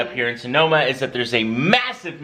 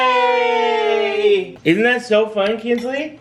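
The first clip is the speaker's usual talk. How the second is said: excited; 7 semitones higher, much slower than usual. Real, not acted. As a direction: cheer the little one on. Cheering toddler after candle.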